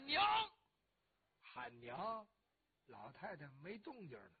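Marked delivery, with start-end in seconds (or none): 0.0-0.2 son calling out to his mother; alarmed call. A narrator's reading. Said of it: "王世充马上喊".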